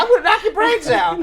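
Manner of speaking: high mock kid's yell; a hammed-up child threat; angry little boy. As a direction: shout it like a furious nine-year-old. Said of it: "the boy yelling".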